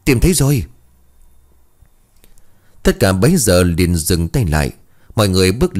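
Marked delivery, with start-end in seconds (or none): 0.0-0.7 found it, called out high; shout. A narrator's reading. Villager shouting to the crowd, excited call. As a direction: cry out the discovery. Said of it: "một người trong đám hét lên".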